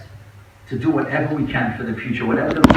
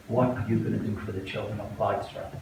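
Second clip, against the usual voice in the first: much softer, lower, haunted echo; haunted hush; 6 semitones lower, 10 dB quieter than usual. Retold or imitated. Speaker recalling words that haunt him, hushed. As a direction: let the question fade like a haunting memory. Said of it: "I'm haunted by those words".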